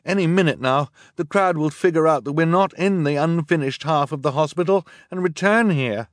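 high, loud, urgent calling; shouting over noise. A narrator's reading. Boy warns his sisters beside the burning hospital, shouting. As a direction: shout a warning over the roar. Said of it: "shouting over the roar of the fire".

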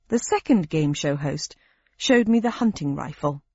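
ordinary delivery, nothing marked

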